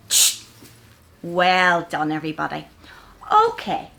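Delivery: ordinary delivery, nothing marked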